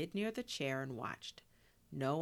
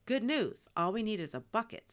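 loud, higher-pitched upbeat announcement; eager, raised-voice news; announcing; 7 semitones higher, 6 dB louder than usual. A narrator's reading. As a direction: announce it brightly to everyone. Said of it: "Sophia announced".

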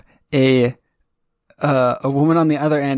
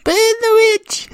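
high, slow mob shout; angry mob leader; 4 dB louder, much slower than usual. Acted. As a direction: cry it out like a torch-waving villager. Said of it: "Monty Python".

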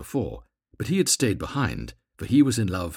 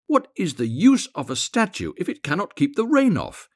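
high, loud exasperated question; indignant complaint; 10 semitones higher, 6 dB louder than usual. A narrator's reading. Swallow grumbling at the dripping statue, indignant complaint. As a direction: complain loudly, pitch climbing.

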